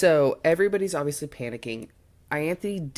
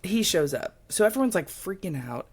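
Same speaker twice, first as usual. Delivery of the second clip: usual speaking voice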